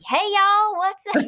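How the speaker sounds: high, bright Southern "Hey, y'all"; Southern accent. Retold or imitated. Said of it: "cross the threshold ... I'm like".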